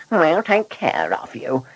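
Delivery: high-pitched mocking echo; mocking mimicry. A narrator's reading. Blunderbuss parroting the driver's promise to the passenger, mocking. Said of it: "he mimicked".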